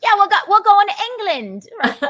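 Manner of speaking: high, loud mock-Cockney; Cockney accent. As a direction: belt it out in playful Cockney. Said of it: "My Cockney accent".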